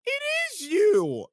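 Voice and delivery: very high, slow squeal of recognition; excited squeal. Retold or imitated. Woman squealing on recognizing Kai. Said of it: "all I hear come out of this woman's mouth".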